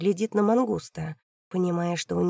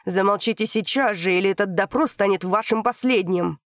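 angry threat; 4 dB louder than usual. Acted. Prosecutor threatens angrily.

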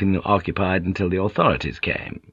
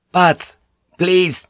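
two separate words, high and louder; pitched-up, unyielding request; insistent demand; 9 semitones higher, 4 dB louder than usual. A narrator's reading. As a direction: repeat it firmly, word by word. Villager repeating his insistent demand.

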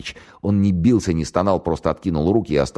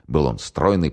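ordinary delivery

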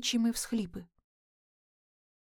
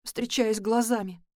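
ordinary delivery, nothing marked

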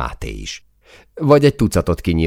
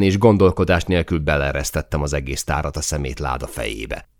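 ordinary delivery

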